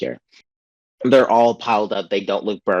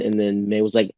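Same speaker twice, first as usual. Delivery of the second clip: ordinary talk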